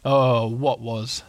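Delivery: slow, dragging Eeyore voice; gloomy donkey voice. Acted. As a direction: drag every word, weary and glum.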